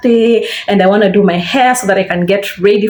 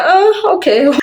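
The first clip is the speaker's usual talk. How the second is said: surprised oh; 10 semitones higher, slower than usual. Retold or imitated. Disappointed reaction to boyfriend.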